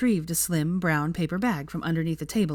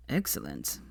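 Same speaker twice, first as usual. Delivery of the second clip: low, quiet, self-satisfied; smug; 13 semitones lower, 7 dB quieter than usual. A narrator's reading. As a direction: savour the word smugly, down low. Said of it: "he said smugly".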